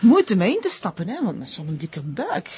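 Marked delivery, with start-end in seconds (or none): 1.1-2.5 softer, slower gossiping aside; confiding hush. Acted. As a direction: lean in and share a secret. Gossip lowering voice about pregnancy.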